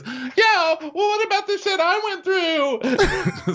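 very high, drawn-out protest; mock wailing shriek. Host mimics Jen protesting, exaggerated wail. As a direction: screech it in hammed-up self-pity. Acted housewife protesting in a fight.

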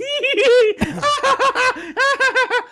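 put-on high, loud girly giggle; imitated girly giggles of the sisters; mock girly giggling. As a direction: cackle like giggling little girls. Siblings giggling.

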